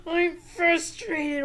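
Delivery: loud, high, slow mock frustration; acted frustration. Shown as a demonstration. Teacher naming our feelings for children, acted frustration. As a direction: huff it out in hammed-up exasperation. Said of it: "name our feelings".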